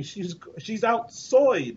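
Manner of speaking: put-on slow drawn-out Scottish accent. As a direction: stretch it out in a Scottish lilt. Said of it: "it was like a Scottish accent".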